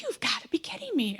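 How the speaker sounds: retold outburst of disbelief; incredulous. Customer seeing the worker smoking out the window, incredulous.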